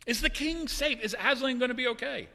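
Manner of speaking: put-on high, anxious child voices; worried children asking about the lion; nervous children. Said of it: "they're like".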